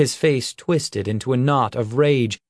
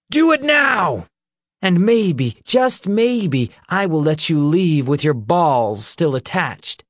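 high, loud menacing yell; shouting; 7 semitones higher, 4 dB louder than usual. Acted. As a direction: bark the threat down at him. Captor yells threat at prisoner.

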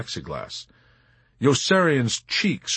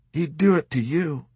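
higher-pitched, insistent retort; arguing; 8 semitones higher than usual. A narrator's reading. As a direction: press the point to persuade him. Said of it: "Dobbs argued".

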